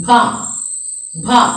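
"bha" with a puff of air; aspirated bha. Shown as a demonstration. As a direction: lips together, then release "bha" with air. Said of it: "a little air is flowing out".

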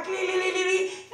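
trilled telephone ringing noise; sing-song ringtone imitation; imitated phone ring. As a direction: mimic a ringing phone with the tongue.

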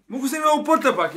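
high mock voice; squeaky imitation of him; imitating a friend. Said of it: "Ja kažem, „Molim?".

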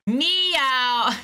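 proud cat imitation; cat meow. Caller proudly meowing on hold, high and loud. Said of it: "best performing cat sound ever".

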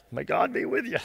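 low, soft, grudging send-off; reluctant mumble. Shown as a demonstration. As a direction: Saul blessing David half-heartedly, muttered. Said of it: "That's kinda funny".

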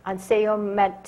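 normal talking voice

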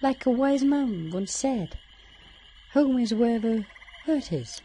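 high, lilting puppet-like voice; wise-quoting character. Acted. Character passing on a saying, in a high put-on voice. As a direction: pitch it up like a little storybook sage.

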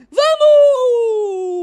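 high drawn-out cry of joy; excited shout. Real, not acted. Equaliser chance, shouting. (grito empolgado)

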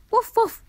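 high, loud playful woofs; dog barking. A narrator's reading. Dog showing the animals who's in charge, loud barks. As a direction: bark like a bossy little dog. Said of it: "barked Molly".

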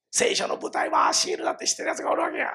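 hushed, secretive aside; conspiratorial whisper. Acted. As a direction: whisper it like a secret only insiders know. (秘密めかしたささやき)

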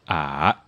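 learner's "ah" dipping low and rising; copying third tone. Shown as a demonstration. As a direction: dip "ah" down, then up. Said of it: "So I'm gonna go".

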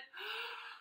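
high, soft breathy gasp; sharp gasp. Acted. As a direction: suck in air before the huff. Narrator performs big breath.

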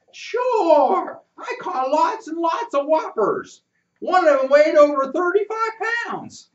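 put-on high, loud piping boy; squeaky voice. Boy brags about his catch to narrator, squeaky and high. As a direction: squeak out a proud fish tale. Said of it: "piped in his squeaky voice".

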